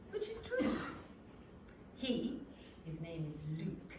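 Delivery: ordinary delivery, nothing marked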